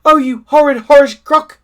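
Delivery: high, loud outraged monkey. Acted. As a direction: shriek at the crocodile. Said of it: "cried Mugglewump".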